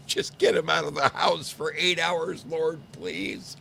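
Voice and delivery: high, pleading wife's prayer, played for laughs; mock pleading prayer. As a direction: beg the Lord in a high, comic voice. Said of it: "the power of prayer".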